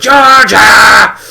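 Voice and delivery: loud, high call of her name; mock monster shouting her name; chasing shout. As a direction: yell after her as she runs.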